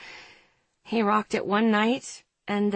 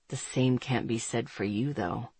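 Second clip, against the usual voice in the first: lower, soft smug male voice; 9 semitones lower, 8 dB quieter than usual. A narrator's reading. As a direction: purr it, low and smug. Narrator voices Andre.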